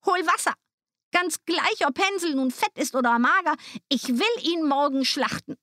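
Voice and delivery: high, commanding witch voice; impatient witch. A narrator's reading. Witch tired of waiting for Hänsel to fatten, impatient. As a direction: order her impatiently as the witch. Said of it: "wurde sie ungeduldig und wollte nicht länger warten".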